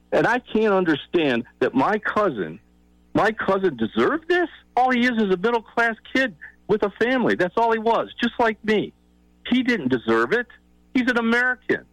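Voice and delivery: high-pitched, incredulous indignation; upset, high-pitched appeal; impassioned protest. Real, not acted. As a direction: plead in disbelief, pitch pushed way up. Impassioned protest on cousin's behalf.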